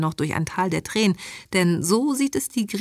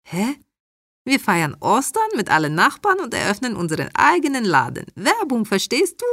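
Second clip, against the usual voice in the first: animated, higher-pitched mother with broken German; immigrant mother figure; 5 semitones higher than usual. Acted. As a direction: play the mother, pitched up and lively, explaining her plan.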